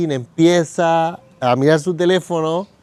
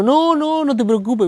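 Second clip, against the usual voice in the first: hurried polite refusal; 8 semitones higher than usual. Retold or imitated. Acts out refusing friend's offer to pay.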